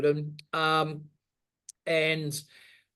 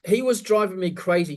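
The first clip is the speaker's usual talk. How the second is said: ordinary delivery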